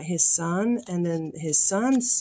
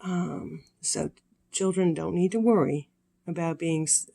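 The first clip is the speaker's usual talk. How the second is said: ordinary delivery, nothing marked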